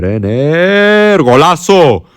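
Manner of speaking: loud, high golazo cry; excited goal shout. Real, not acted. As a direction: belt out the goal with joy. Shouting at his own goal.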